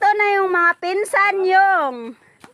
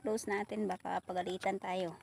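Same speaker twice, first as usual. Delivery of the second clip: hushed; 10 semitones lower, 15 dB quieter than usual. Real, not acted. Afraid of getting scolded.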